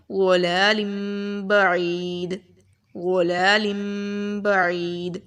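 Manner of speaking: وَلَالٍ بَعِيدٍ with the ending ن turned into a nasal م; measured recitation changing the ن sound to م; iqlab recitation. Shown as a demonstration. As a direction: recite وَلَالٍ بَعِيدٍ as a careful Quranic reading, nasal م before ب. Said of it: "ہم نے لن نہیں پڑھا".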